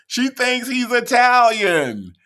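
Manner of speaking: high, slow, drawn-out holler; incredulous laughing shout. Real, not acted. Reactor hollers to viewers about her mistaken belief, incredulous shout. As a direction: shout it out in amused disbelief. Exclaims the absurd belief again.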